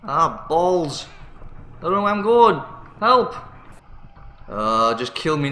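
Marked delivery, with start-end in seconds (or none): none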